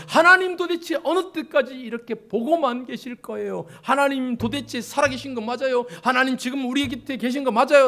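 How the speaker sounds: loud, high-pitched demanding questions; heated protest. Acted. Prophet questioning whether God is really alive, heated protest. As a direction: challenge God in exasperated protest. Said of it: "라고 막 항변한다".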